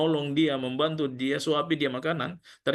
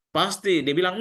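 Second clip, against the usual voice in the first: normal talking voice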